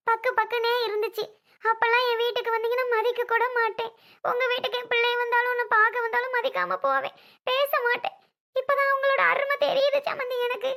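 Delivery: high-pitched tearful confession. Acted. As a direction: pour out regret, close to weeping. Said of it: "அழுதுகிட்டு இருக்கீங்களா".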